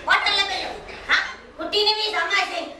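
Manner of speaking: ordinary delivery, nothing marked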